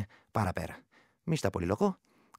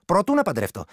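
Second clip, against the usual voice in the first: eager insistence; 6 semitones higher, 7 dB louder than usual. Acted. Woman insists, showing her charm.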